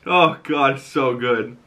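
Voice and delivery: ordinary delivery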